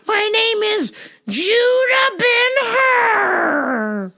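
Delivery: very high, slow quavering blessing; preachy old lady. Retold or imitated. Old caller blessing the clerk, high and slow.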